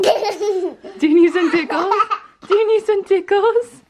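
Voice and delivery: high, giggly singsong teasing; giggly tickle attack. Real, not acted. Tickling toddler, high laughing voice.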